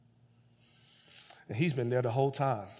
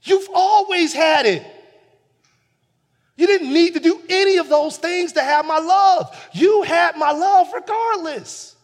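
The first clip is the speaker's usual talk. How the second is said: much higher and louder yet slow, earnest; father reassuring the bitter older son; loving father pleading; 13 dB louder than usual. Retold or imitated. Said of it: "He said".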